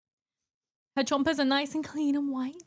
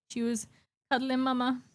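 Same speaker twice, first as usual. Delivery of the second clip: usual speaking voice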